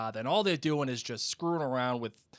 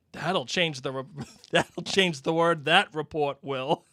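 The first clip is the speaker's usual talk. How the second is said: breaking up into laughter mid-line; laughing through Ron's sarcasm; laughing while reading; 3 semitones higher, 5 dB louder than usual. Acted. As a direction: try to read sarcastically but crack up. Ron mocking, reader laughing.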